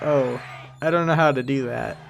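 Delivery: usual speaking voice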